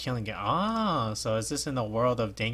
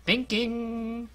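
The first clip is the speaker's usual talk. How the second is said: strained high character read; failed try at a game voice; high put-on voice; 11 semitones higher than usual. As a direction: pitch it way up. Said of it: "I can't do that".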